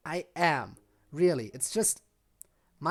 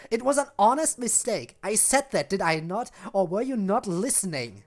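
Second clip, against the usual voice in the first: loud cold retort; angry retort; 6 semitones higher, 9 dB louder than usual. Acted. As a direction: snap back, offended and loud. Heated argument between characters.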